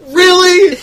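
high, loud incredulous outburst; mock disbelief. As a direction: call out like a fed-up moviegoer. Voicing exasperated audience reaction.

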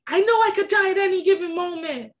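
loud, fast angry outburst; shouted retold outburst; snapping in anger. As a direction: lash out at your sister. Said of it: "I just snapped".

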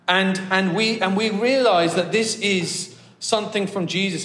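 rally the congregation; fervent preaching. Acted. Preaching at full force.

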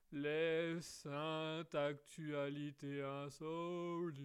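very slow, grand title read; segment announcer. Pretend. Host to listeners, slow announcer-style segment title. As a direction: announce the segment like a radio jingle.

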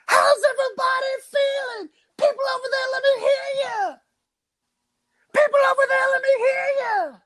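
high, loud arena-frontman shout. Acted. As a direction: hype the crowd like a rock star on stage. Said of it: "the voice is making his way to the stage".

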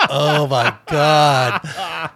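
very slow, stretched-out retold groan of horror; stunned, dragged-out reaction; drawn-out dismay. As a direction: drag out every word in mock dismay. Group realizes they ate stranger's cake.

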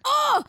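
sudden high "oh" of irritation; high-pitched squeal of annoyance; exasperated high cry. Real, not acted. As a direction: cry out as if it grates. Reacting to being called hun.

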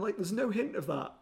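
normal talking voice

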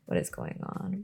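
puzzled aside under the breath; whispered confusion; baffled whisper. Real, not acted.

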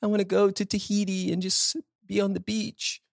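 high, airy wishful tone; dreamy wishful voice. Retold or imitated. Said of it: "What's the thing you want?".

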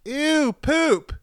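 put-on very high, loud kid voice; ten-year-old heckler. Commuter heckling a dog walker, childish yell.

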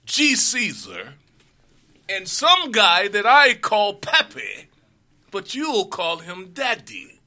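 loud, high proclamation voice; town crier. Pretend. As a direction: announce it like a town crier calling the square. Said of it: "I just gave y'all a little taste of how the, uh, next promo gonna be".

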